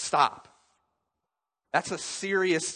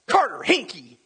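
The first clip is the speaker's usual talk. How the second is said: mock pained yell; 6 semitones higher, 7 dB louder than usual. Pastor acting out stubbing his toe, mock pained yell. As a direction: yell a name like you stubbed your toe. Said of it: "then I might scream his name".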